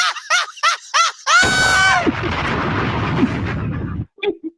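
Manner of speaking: big high cackling laugh. Real, not acted. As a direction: howl with laughter. Caller cracks up at own story.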